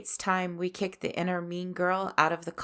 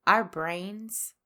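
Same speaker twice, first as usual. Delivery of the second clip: normal talking voice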